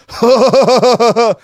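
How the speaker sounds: high, loud burst of laughter; real laugh, high and loud. Real, not acted. Laughs at own hipster bit.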